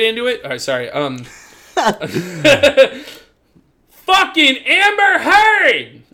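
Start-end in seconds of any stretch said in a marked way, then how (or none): 3.9-6.0 high, long-drawn shout of the name; over-the-top exasperated bellow; mock enraged yell. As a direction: holler it like announcing a nemesis. Mock outburst announcing next topic.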